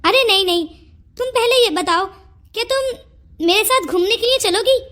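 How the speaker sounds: ordinary talk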